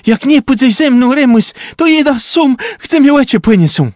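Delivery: near-scream, fast at the end; high, loud shout. A narrator's reading. Trumba shouting to Remus to come along, a high loud plea. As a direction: yell it high and loud, pleading.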